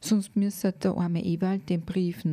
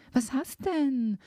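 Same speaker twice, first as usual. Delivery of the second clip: das zweite „Was hast denn?“ höher und langsam nachgehakt; drängender nachgefragt; 7 semitones higher than usual. A narrator's reading. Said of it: "fragte ich". (drängend und höher wiederholte Frage)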